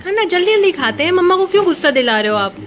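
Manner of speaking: mother scolding her son for not eating; irritated scolding. Real, not acted. Mother scolding dawdling child.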